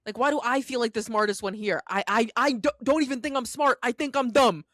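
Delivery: loud, high exasperated rant. Real, not acted. Venting to the co-host about feeling like the smartest, exasperated.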